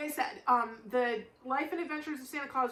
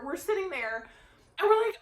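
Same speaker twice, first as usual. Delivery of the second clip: normal talking voice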